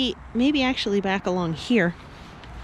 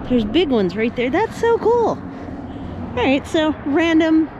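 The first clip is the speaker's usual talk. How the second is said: raised, excited voice over noise; yelling over helicopter; 7 semitones higher than usual. Real, not acted. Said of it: "Sorry about the helicopter".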